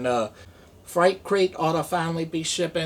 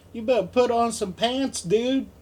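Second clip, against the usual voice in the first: high mock scold; 8 semitones higher than usual. Voices someone scolding.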